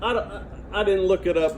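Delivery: normal talking voice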